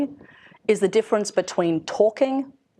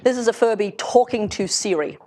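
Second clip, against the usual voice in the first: ordinary talk, nothing marked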